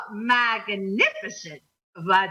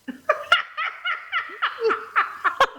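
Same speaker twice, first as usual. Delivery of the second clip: burst of laughter; 12 semitones higher than usual. Real, not acted. Laugh after guess.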